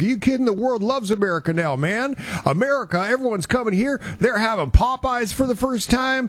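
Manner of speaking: imitating Brandon. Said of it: "Brandon does not sound like that".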